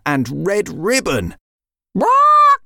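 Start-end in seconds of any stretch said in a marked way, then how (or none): 1.9-2.6 very high, loud startled "What?"; shocked cry. Acted. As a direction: jump in alarm. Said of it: "cried Red Ribbon".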